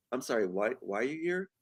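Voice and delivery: soft, hesitant puzzled question; puzzled question to police. Retold or imitated. Accused person asking the police why they came, softly puzzled. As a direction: ask it quietly, as if baffled. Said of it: "you're with the police going like".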